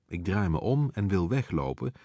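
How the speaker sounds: usual speaking voice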